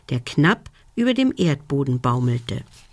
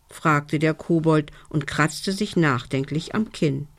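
ordinary delivery

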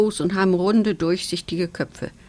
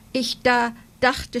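higher, slow, halting words; stammering; 6 semitones higher, slower than usual. A narrator's reading. Little mouse stammers to the star fairy, higher and halting. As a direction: trip over the words in awe. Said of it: "stammelt er".

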